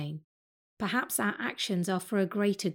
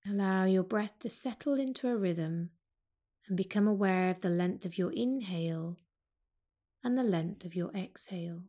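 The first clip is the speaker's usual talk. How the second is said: calm yoga teacher guiding a breathing practice; guided meditation. Acted. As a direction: ease them into the breath, unhurried. Leads a seated breath meditation.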